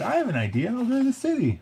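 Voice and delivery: high, bright-eyed eager voice; eager Flick voice. A narrator's reading. Flick retelling his plan to the council, high and eager. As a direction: chirp it like an excited young ant. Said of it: "He said".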